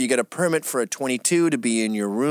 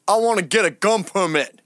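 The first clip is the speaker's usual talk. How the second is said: imitating vet; 8 semitones higher, 5 dB louder than usual. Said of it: "And he goes".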